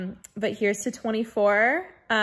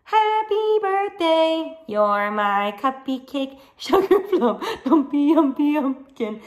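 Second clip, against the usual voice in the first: voicing little sister; 4 semitones higher, 3 dB louder, slower than usual. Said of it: "Little sissy says".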